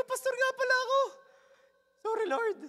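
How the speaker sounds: tiny, very high-pitched voice; comically high, chastened plea; retold sheepish remorse. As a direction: squeak out a mock-guilty apology. Acting out remorse for laughs.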